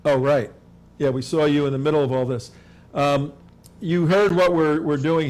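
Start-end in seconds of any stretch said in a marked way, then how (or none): none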